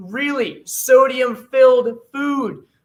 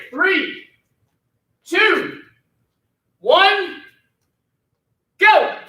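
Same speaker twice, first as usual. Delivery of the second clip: rising, louder coach count; countdown shout; 8 semitones higher, 3 dB louder, much slower than usual. Real, not acted. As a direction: call out the start high and loud.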